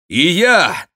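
high, loud call; shout. A narrator's reading. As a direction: shout it out to announce yourself. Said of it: "крикнул второй голос". (громкий высокий крик)

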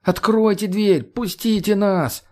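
high, slow pleading call; calling through window. Acted. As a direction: call out to them behind the glass. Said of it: "продолжил в него стучать". (громко зовёт в окно)

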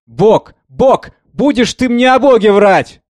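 very high, loud anguished scream; cry of pain. A narrator's reading. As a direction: tear it out of her in agony. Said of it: "закричала с болью".

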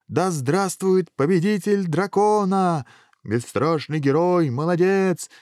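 high, drawn-out acclaim; crowd's cheering. A narrator's reading. Crowd greeting the hero, jubilant high cheering. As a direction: hail the champion in a high, stretched call. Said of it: "кричала толпа".

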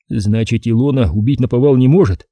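ordinary delivery

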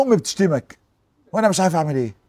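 soft, low, broken-hearted plea; a hushed, grieving confession; tearful and quiet. Retold or imitated. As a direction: speak it softly, near tears. Weeping man tells the Prophet.

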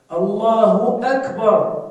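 teaching demo of the takbir; correct أكبر. Shown as a demonstration. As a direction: model the right call carefully. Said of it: "عليك أن تقول أكبر وليس وكبر".

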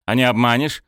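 cocky smirking question. A narrator's reading. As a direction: tease her with a grin. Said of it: "усмехнулся Виктор".

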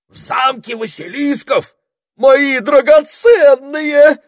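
piercingly high, loud squeal, stretched out. A narrator's reading. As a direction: squeal in horror just before fainting. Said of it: "взвизгнула Табита и хлопнулась в обморок".

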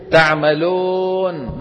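تعملون ending in a long held "-ohn"; impure waw. Retold or imitated. Teacher mimics a faulty reciter, waw turned to oh. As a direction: chant تعملون with oh in place of oo. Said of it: "بدل ما يقول تعملون".

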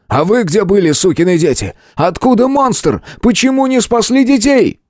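outraged yelling. A narrator's reading. Hero berates the soldier over children, yelling. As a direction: lose control and holler accusations. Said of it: "не смог сдержаться и заорал".

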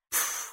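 short, soft puff of disdain; voiceless scoffing pff; scornful snort. A narrator's reading. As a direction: blow out a dismissive huff. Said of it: "фыркнула Хилла".

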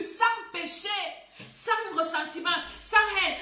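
loud, high-pitched fervent sermon; preaching at full force. Acted. (prédication enflammée, voix forte)

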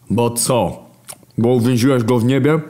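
mock the Creator; Hunger. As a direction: Hunger sneers at God. Hunger challenges God.

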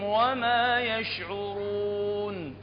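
slow, drawn-out chanted tajweed; Quran recitation. Shown as a demonstration. Teacher reciting verses to students in slow chant. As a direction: chant each word long and melodic.

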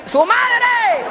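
high, drawn-out yell; shouted insult. Acted. Spectator yells at bobsledders racing past, shouted insult. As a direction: heckle from far off at the top of your voice. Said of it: "yo les grité".

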